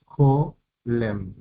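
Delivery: jolem in two separate beats, jo... lem; syllable by syllable. Shown as a demonstration. Teacher showing jolem has two syllables, split apart. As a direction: pause between jo and lem in jolem. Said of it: "tenemos dos sílabas".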